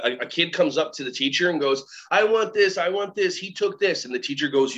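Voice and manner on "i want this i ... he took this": put-on high-pitched child's complaint; mock kiddie whine, raised pitch; demanding kid. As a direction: play a tattling little kid. Kid complaining to teacher, acted.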